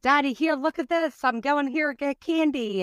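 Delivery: put-on high, excited child's voice; kid voice. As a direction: chirp it like a thrilled kid. Mother voices excited kids.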